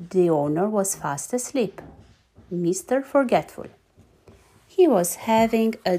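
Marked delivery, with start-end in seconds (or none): none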